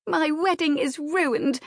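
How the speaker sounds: high, distressed insistence; insistent complaint. A narrator's reading. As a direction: lament it insistently, pitched well up. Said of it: "she insisted".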